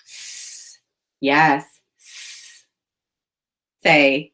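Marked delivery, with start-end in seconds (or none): 0.1-0.8 S as a long voiceless sss, not the letter name; isolated final sound. Shown as a demonstration. Teacher isolates the end of miss, S as a long sss. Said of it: "What's the final sound". 2.0-2.7 S as a long voiceless sss, not the letter name; isolated final sound. Shown as a demonstration. Teacher isolates the end of miss, S as a long sss. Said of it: "What's the final sound".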